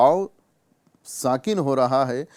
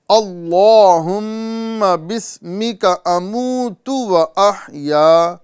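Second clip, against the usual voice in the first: very slow, higher, louder chanted "اللہم بسمک اموت و احیا"; slow recitation; 5 semitones higher, 6 dB louder, much slower than usual. Shown as a demonstration. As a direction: recite each syllable stretched for students to copy. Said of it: "آپ بھی ساتھ ساتھ پڑھنے کی کوشش کریں".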